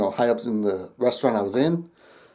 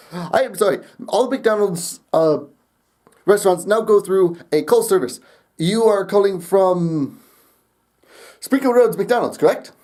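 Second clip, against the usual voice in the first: higher, put-on Indian accent; 6 semitones higher than usual. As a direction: play a call-service agent with an Indian accent. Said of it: "kept the accent the whole time".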